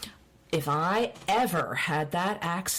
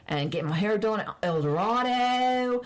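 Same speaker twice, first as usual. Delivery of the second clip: Baltimore accent; 6 semitones higher than usual. Shown as a demonstration. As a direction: lay the Baltimore accent on thick. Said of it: "I love the accent".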